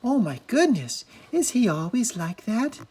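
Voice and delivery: high, amazed question. A narrator's reading. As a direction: gasp it out in disbelief.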